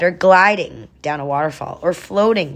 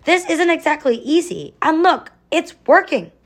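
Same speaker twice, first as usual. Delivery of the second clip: high-pitched call over the noise; shouting back; 11 semitones higher than usual. A narrator's reading. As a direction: keep calling back, voice pitched up. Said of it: "Sage shouted back".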